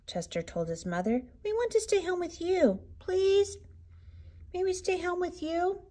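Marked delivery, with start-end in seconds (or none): 1.4-5.8 put-on high whiny child voice; pleading child. A narrator's reading. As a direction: beg like a small child. Cubs begging to stay home.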